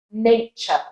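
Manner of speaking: British nature ending in "chuh"; slow nature without an R, British; British accent. Shown as a demonstration. Teacher modelling nature for learners, British accent. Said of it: "So in a British accent".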